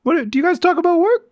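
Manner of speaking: very high falsetto, mock-casual questioning; mock probing voice. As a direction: squeak out nosy questions in a put-on high voice. Mimicking Bernard casually probing the wife.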